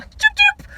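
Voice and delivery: very high whistled choo; mock whistling choo. Imitating a smug driver, very high mock choo. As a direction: toss off a carefree whistle. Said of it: "people walk out on some".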